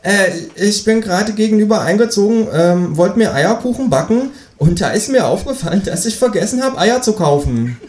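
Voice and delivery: a bright squeaky kangaroo hello. Acted. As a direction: kangaroo at the door greeting neighbour. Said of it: "kangaroo speaking".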